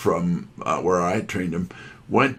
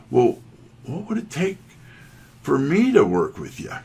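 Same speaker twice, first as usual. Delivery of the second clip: mustering courage; 7 semitones higher, 3 dB louder than usual. Retold or imitated. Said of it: "trying to have as much courage as I possibly could".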